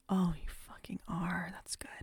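ordinary delivery

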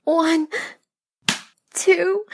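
high, loud cried-out count; pained counting aloud. Acted. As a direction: cry out each number on impact. Counting aloud during acted paddling.

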